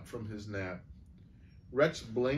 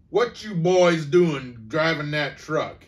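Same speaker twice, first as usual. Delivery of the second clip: louder, slow sheriff's growl; growled question; 4 semitones higher, 8 dB louder, slower than usual. A narrator's reading. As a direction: growl it at the boys. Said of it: "he growled".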